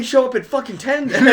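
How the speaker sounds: loud exasperated laughing demand. Retold or imitated. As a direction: throw it out in disbelief. Exasperated mock advice.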